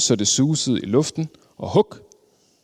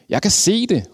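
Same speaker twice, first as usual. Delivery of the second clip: excited calling out; 8 semitones higher than usual. A narrator's reading. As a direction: call it out as a sudden discovery. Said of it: "råbte den tredje træ".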